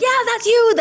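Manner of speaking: bouncy overexcited director; imitating a hyper Parisian producer shouting. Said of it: "really super hyper".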